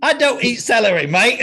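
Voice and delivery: high, loud Cockney complaint; a Cockney grumbling about his food; Cockney accent. Pretend. Friend mocks a meal in Cockney accent. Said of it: "Why am I like a Cockney British?".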